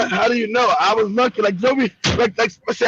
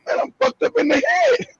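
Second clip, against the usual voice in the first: laughing; 10 semitones higher than usual. Retold or imitated. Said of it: "I was like".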